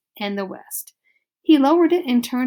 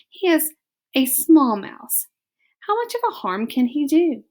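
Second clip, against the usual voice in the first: dismissive; 6 semitones higher, 3 dB louder than usual. Acted. Antoinette dismissing his worry.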